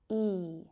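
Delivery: E held long on its own; isolated, drawn-out E; long vowel. Shown as a demonstration. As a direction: sustain a pure ee for E. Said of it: "That long vowel sound at the end".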